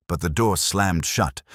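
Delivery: ordinary delivery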